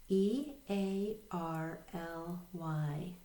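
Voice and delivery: slow, letter-by-letter spelling; spelling aloud. Shown as a demonstration. Teacher leading learners in read, spell, read, spelling aloud. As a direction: spell it out slowly, a gap after each letter. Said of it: "read, spell, read with me".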